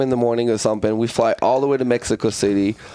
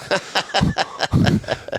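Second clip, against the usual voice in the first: breathy laugh; 9 semitones higher than usual. Real, not acted. Laughing at himself.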